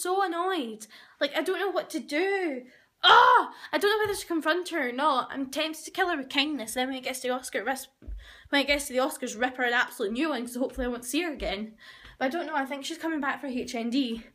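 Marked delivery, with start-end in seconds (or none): none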